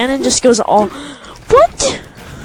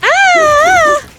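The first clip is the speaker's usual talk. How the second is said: high scream; 3 dB louder than usual. Real, not acted. Sudden in-game shock.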